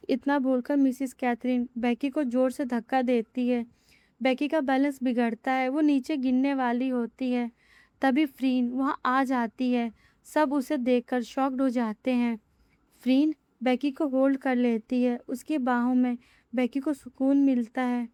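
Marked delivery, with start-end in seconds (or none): none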